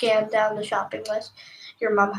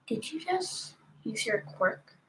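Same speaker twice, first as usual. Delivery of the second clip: much softer, slow simmering question; softer, slow, a father's held-in anger; low boiling anger; 10 dB quieter, slower than usual. A narrator's reading. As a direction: hold the fury down low. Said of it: "in a low, frustrating, boiling tone".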